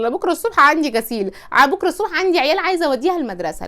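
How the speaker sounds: loud, brisk mock married-couple exchange; hammed-up no-nonsense wife; dutiful, unromantic wife. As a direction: rattle it off like a businesslike household routine. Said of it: "في فرق ما بين انا راضيه وفي فرق ما بين انا مدلعاه".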